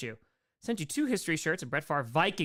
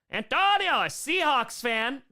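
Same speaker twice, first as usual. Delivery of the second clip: loud, high hail of a fan; hyped-up name announcement; excited announcement; 8 semitones higher, 6 dB louder, slower than usual. Real, not acted. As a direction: call it out big, stretching the words. Streamer hails a fan excitedly.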